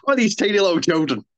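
usual speaking voice

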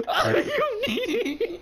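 high, slow words squeezed through laughing; helpless laughter. Real, not acted. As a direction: barely get it out while cracking up. Still in a laughing fit.

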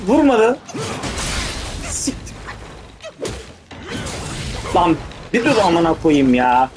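ordinary delivery

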